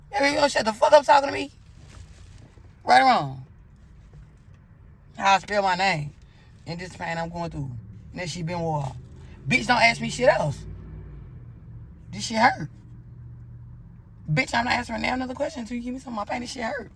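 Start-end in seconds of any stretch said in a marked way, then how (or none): 0.1-6.1 much louder and higher, yelling in pain; furious yell. Retold or imitated. Cursing out the doctor in pain. 9.5-16.9 much louder and higher, yelling in pain; furious yell. Retold or imitated. Cursing out the doctor in pain.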